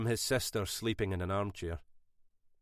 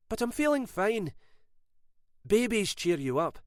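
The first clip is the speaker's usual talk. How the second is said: bright; 11 semitones higher than usual. A narrator's reading. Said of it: "She answered brightly".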